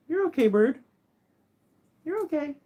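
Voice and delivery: high soothing pet voice; high reassuring coo to a parrot; reassuring bird. Real, not acted. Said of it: "I gotta hype him up".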